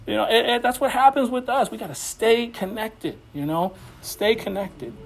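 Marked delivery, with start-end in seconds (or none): none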